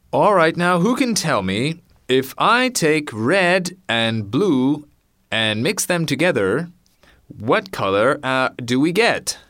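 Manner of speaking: loud, high classroom question voice; teacher asking. Acted. Teacher quizzing the class on colors, loud and high. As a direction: quiz the whole class, loud and clear. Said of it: "the teacher was saying".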